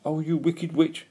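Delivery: lightly voiced heckling; mocking shout. A narrator's reading. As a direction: jeer at the witch. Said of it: "mocked the Pooks, shouting at the gull".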